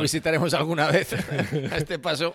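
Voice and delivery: laughing. Real, not acted. Comedian joking with the host about his football team, laughing. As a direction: cracks up mid-sentence at his own joke.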